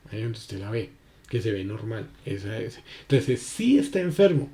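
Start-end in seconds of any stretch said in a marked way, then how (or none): 3.4-4.5 "sí" raised and firm; certain, stressed "sí". Shown as a demonstration. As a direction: hit "sí" high with certainty. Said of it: "ya tiene la seguridad".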